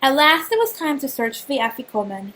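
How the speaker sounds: plain reading voice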